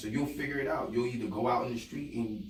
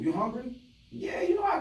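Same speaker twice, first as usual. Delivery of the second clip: acted eager exchange; 13 semitones higher, 4 dB louder than usual. Acts out being asked if hungry.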